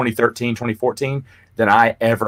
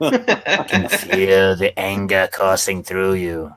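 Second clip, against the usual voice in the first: slow tempting villain voice, laughing; tempting devilish whisper; She voice; 3 semitones lower, slower than usual. Retold or imitated. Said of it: "in my best She voice".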